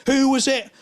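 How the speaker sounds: high, loud snapped question; sharp demand. Retold or imitated. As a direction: girlfriend snaps at him from bed. Girlfriend demanding who called.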